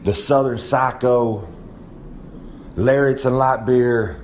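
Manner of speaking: ordinary talk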